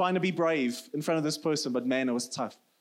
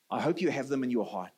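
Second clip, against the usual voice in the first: normal talking voice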